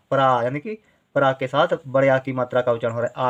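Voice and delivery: usual speaking voice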